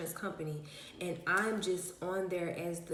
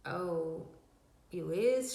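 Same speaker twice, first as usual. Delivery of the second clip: mock surprise; much slower than usual. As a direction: fake astonishment, pitched up. Mock reply to friend's offer.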